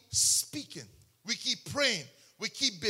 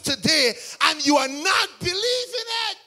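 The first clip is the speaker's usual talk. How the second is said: preaching shout; 7 semitones higher, 9 dB louder than usual. Real, not acted. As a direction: preach it out to the crowd, at full force. Preacher exhorting the congregation.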